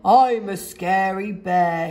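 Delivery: slow, sniffling bear voice; a lonely bear holding back tears. A narrator's reading. Lonely Boris sniffling to himself, slow and sad. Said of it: "he sniffed".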